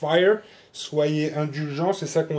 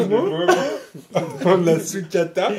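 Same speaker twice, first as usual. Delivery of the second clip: laughing; 3 semitones higher, 3 dB louder, slower than usual. Real, not acted. Joking repeat while laughing.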